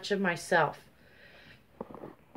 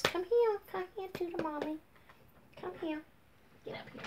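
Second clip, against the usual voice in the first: soft, high sing-song to a pet; little high-pitched mommy voice; pet coaxing; 10 semitones higher, 9 dB quieter, faster than usual. Real, not acted. Coaxing her pet over.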